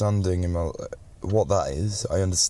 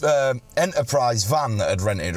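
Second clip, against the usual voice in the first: ordinary talk, nothing marked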